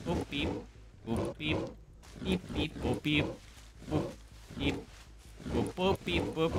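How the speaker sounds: soft little boops and beeps; softly voiced boop sound effects; boop-beep noises. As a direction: toot out quiet, playful beeps. Imitates booping noises while waiting.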